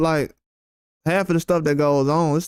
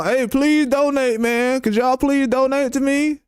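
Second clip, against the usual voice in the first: higher, slow pleading beg for donations; pitched-up mock plea for donations; mock begging yell; 7 semitones higher, slower than usual. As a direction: ham up a streamer begging for money. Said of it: "Have you heard me yell out right now".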